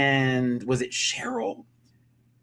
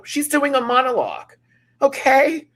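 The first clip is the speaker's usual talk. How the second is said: high, loud incredulous rant; mock outrage; 14 semitones higher, 9 dB louder than usual. Real, not acted. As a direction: escalate to a high-pitched comic shout. Escalating comic outrage at the performance.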